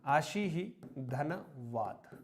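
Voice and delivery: आशी ही धनवाद said slowly and softly; slow, soft teaching split; slow careful split. Shown as a demonstration. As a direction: stress each part of आशी ही धनवाद slowly. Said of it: "बहुत महत्वपूर्ण शब्द है".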